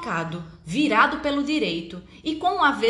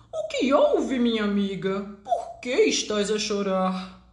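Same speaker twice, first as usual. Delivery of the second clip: duck voice. Acted. Duck asks hen.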